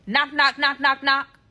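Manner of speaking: high, loud, even staccato knocks; spoken knocking. Retold or imitated. Storyteller voicing someone at the door, rapping knocks. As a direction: punch each knock like a fist on a door.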